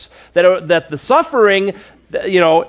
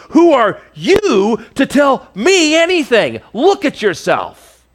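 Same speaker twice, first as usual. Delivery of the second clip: higher and slower, a sneering put-down; mock indignant scoff; 8 semitones higher, slower than usual. Preacher voicing Zophar scorning Job, higher and slow. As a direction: sneer at the man in the ash heap. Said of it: "He's clearly ticked".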